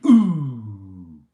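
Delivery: loud falling ooh; mock buzzer ooh. Closing the Countdown jingle with a loud falling ooh. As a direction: drop off the end like a quiz show sting.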